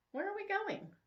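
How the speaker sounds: high, curious question; Bethany being led away, high and curious; puzzled young woman. Acted. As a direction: ask it high and surprised. Bethany led across the street.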